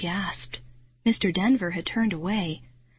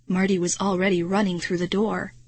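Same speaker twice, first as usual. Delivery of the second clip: usual speaking voice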